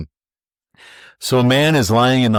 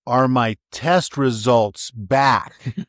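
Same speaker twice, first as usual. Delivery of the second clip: punchline; 2 semitones higher, much slower than usual. Retold or imitated. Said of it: "He says".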